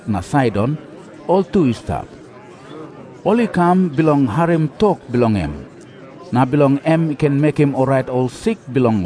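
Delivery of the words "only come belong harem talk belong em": usual speaking voice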